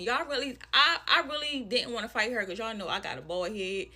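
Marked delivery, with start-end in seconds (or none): none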